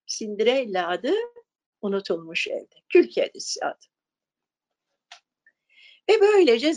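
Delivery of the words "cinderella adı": ordinary delivery, nothing marked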